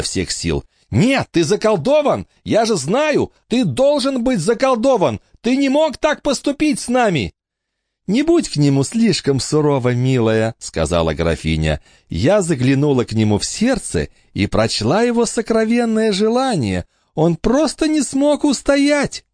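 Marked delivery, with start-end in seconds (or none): 0.9-7.3 much higher, desperate pleading cries; desperate girl. Acted. Emma desperately shaking her brother, crying out. 8.1-10.5 higher, sweet patronizing voice; patronizing countess. Acted. Countess mockingly soothing Emma, higher. 12.1-19.2 sweet countess. Acted. Countess explains Michael's desire.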